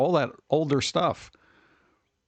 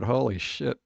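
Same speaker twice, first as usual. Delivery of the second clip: ordinary talk, nothing marked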